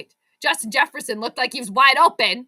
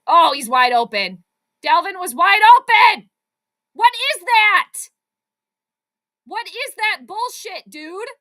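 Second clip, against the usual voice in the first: loud, high outburst at the screen; frustrated shout; 5 semitones higher, 9 dB louder than usual. Real, not acted. Fan yelling at the Vikings over a missed open receiver, loud and high. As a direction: yell at the team in disbelief. Angry reaction to a missed play.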